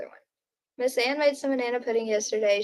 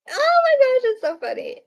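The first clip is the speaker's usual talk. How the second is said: squealing oh my gosh, much higher; reacting with delight to a funny cow video; 13 semitones higher, 4 dB louder than usual. Real, not acted. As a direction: burst out in delight at the clip.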